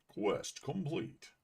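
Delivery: slow, drawn-out announcer imitation; imitating game announcer. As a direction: mimic the game voice slowly. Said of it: "I love the way it says that".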